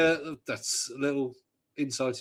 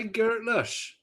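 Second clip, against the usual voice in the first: high, put-on Bristol accent; imitated high-pitched Bristolian; Bristol dialect accent; 11 semitones higher than usual. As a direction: mimic a Bristol local, pitched up. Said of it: "where people say".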